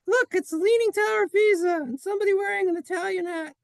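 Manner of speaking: very high falsetto puppet voice; squeaky little character. Acted. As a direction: squeal it out quickly like a tiny character. Excited little character spots the picture.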